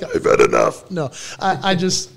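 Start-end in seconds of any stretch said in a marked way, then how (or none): 0.0-0.9 loud, very low mock growl; fed-up outburst. Dad at his limit with nonstop calling, fed-up. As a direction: imitate a dad snapping, dropped low. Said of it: "and I'm like".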